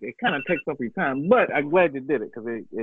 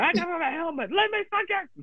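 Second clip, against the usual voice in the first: much higher squeaky come-on; high mock voice; 3 dB quieter than usual. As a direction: go way up and beg playfully. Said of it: "That's what I'm saying".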